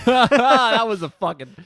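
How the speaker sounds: loud, laughing outburst; laughing loudly. Real, not acted. Laughing at the bad trailer.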